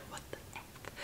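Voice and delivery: voiceless, barely audible whisper; shocked whisper. Acted. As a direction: mouth it in stunned whisper. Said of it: "She's like".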